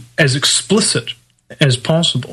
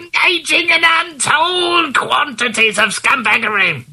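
high-pitched public denunciation through a megaphone; megaphone shout; 9 semitones higher than usual. Shown as a demonstration. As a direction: keep blaring it like an amplified announcement. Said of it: "on a megaphone, for instance".

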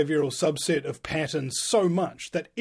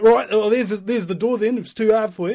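loud, raised-pitch mock scolding; stern dance teacher; 6 semitones higher, 8 dB louder than usual. Dance teacher telling complaining student to leave, loud mock scolding. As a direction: imitate an impatient instructor dismissing a pupil. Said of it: "And he's like".

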